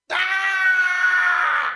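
long, high, loud imitation scream; imitated woman's scream. Woman screaming in the dark distance, imitated scream. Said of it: "I fucking heard, like, a woman scream".

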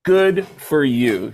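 very slow flat brush-off; deadpan drawn out. Retold or imitated. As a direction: let each word drop, unimpressed. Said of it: "she said".

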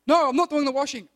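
loud, indignant mock teenager; defiant refusal. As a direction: blurt out a stubborn no, loudly. Acted refusal to a chore request.

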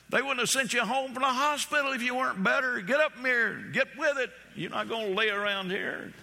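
old husband scolding his wife, hammed up high; fussing husband. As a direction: nag her to get up and work. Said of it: "He was fussing at her".

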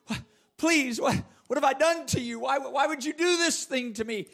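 high, anguished cry to his brothers; anguished pleading. Acted. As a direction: cry out betrayed, begging for mercy. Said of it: "pleading anguish of his soul crying out".

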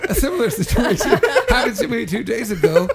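high words breaking into laughter; laughing while quoting. Real, not acted. Friend repeating the cab driver's story, laughing. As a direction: try to quote the driver while cracking up.

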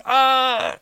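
loud, high-pitched frightened shriek; scream. A narrator's reading. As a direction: shriek in terror. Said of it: "screamed Lola".

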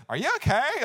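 high, bewildered check-in; puzzled girlfriend. Retold or imitated. Girlfriend checking on sobbing boyfriend, high puzzled voice.

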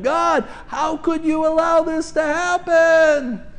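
high, slow wounded protest to God; hammed-up plea to God; mock anguished cry. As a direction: cry out like a betrayed child. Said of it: "yelling and screaming".

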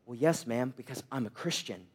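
low, self-assured hero reply; mock hero. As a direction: drop low like a calm rescuer. Imagined hero answers panicked woman.

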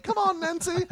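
a silly high call; squeaky coaxing. Retold or imitated. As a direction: beckon in a cartoonish squeak. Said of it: "and he's like".

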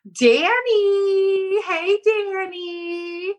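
high, long-stretched mock wail; drawn-out calling. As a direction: call out the name in a playful long whine. Playful drawn-out calling of a name.

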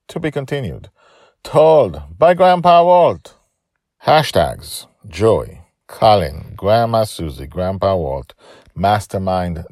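bold, loud list-reading that eases toward the end; announcer sign-off. Acted. Storyteller closes the episode with title and hashtags, announcer style. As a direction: call out each item as a separate announcement.

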